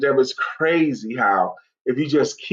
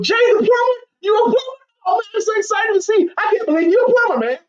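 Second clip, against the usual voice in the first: shouted much higher and fast, thrilled to meet a plumber; overexcited greeting; faster than usual. Retold or imitated. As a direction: gush over the plumber like he's a celebrity. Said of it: "What if we treated everyone like that".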